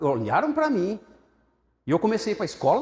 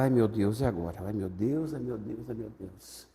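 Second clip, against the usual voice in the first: anxious fretting; 5 semitones lower, 4 dB quieter, slower than usual. Retold or imitated. His anxious repeated fretting.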